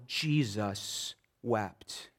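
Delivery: very slow, low and soft, each word weighed; solemn hushed scripture line; slow hushed reading. Shown as a demonstration. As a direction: let Jesus wept sink in, quiet and drawn out. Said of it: "shortest verse in the Bible".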